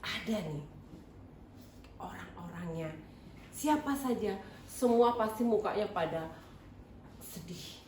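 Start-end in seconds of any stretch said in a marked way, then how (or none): 0.1-2.9 stage whisper. Acted. Children's teacher building suspense.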